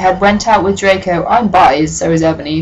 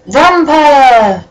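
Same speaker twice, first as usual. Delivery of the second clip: higher, louder dramatic announcement; dramatic reveal; 6 semitones higher, 5 dB louder than usual. Acted. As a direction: reveal it like a horror title. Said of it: "were the words".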